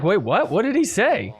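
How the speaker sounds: imitating a baffled crowd, pitch swooping up; high, incredulous mimicked questions; mock surprise. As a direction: act out puzzled disbelief. Acts out everybody's confused reaction.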